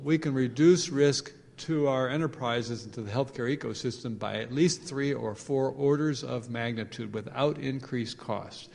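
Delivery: ordinary delivery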